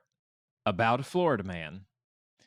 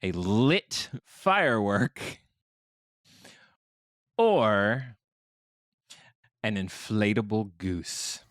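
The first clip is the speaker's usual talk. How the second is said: news announcer; slower than usual. Acted. Host offers players the missing word, news announcer voice. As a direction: quiz options in announcer delivery, long pauses between. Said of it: "news announcer voice".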